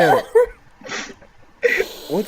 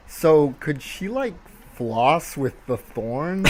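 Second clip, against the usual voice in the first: slow musing; 3 semitones lower, 5 dB quieter, much slower than usual. Retold or imitated. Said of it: "and I'm like".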